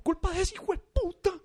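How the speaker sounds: high, loud, ranting barber; hammed-up furious barber; mock outrage. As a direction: rant like a barber gone crazy. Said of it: "se vuelve loco y empieza".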